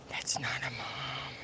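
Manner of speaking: "That's not a mom" breathed out as a soft whisper. Real, not acted. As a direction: whisper it, drained. Repeated denial, fading to whisper.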